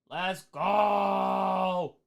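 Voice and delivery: high, long-held cheer; excited, drawn-out hype call; hyped cheer. Real, not acted. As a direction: stretch the last word into a rallying call. Cheering on subscriber count.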